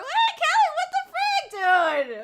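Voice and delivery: shrieking high and very loud, laughing and half crying; screaming in shock. Real, not acted. Streamer shrieking at Kelly for twenty gifted subs, shocked excited scream. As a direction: scream her name in stunned disbelief.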